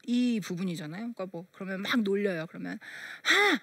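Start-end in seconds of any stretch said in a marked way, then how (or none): 3.2-3.6 mock scoff. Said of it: "막 이런 것도 연습하고".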